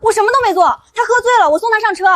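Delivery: high-pitched, insistent protest; flustered denial. Acted. Denies colleague's accusation. (急忙否认)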